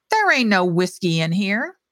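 usual speaking voice

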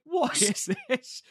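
high, slow, bewildered squeal; baffled squeak. Retold or imitated. Host mimicking the odd pair's antics, high baffled squeak. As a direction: pitch way up in mock bewilderment. Mock baffled quote of the scene.

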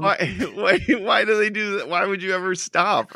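high, loud laughing disbelief; can't believe anyone would do that; real amused shock; incredulous. Real, not acted. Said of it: "incredulous laughing questions".

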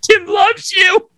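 high, slow crying shout; hammed-up sobbing. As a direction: sob it over the dying table. Comforting dying table.

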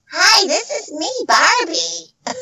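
mocking copycat doll voice; off-target doll impression, high and put-on; imitation Barbie. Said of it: "girls trying to sound like me".

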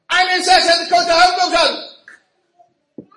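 retelling the coach's loud greeting to the Rebbe; passionate loud introduction. Retold or imitated. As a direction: blurt it out at full volume like an overexcited fan. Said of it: "very vociferously, very passionately".

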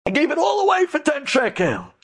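high, wailing lament; a man who just realised his loss; begging. Acted. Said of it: "he's begging the guy".

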